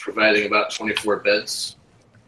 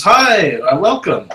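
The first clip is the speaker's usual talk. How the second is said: high, loud, welcoming hello; waving woman's greeting; 7 semitones higher, 6 dB louder, slower than usual. Acted. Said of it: "starts waving at you and says".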